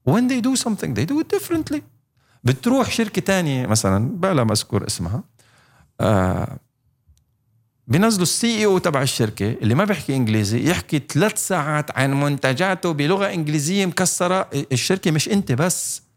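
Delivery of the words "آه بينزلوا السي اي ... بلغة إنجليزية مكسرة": usual speaking voice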